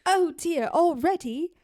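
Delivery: high-pitched British housewife; attempted British accent. Mrs. Rogers fussing over the shopping, attempted British accent. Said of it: "I'm gonna attempt".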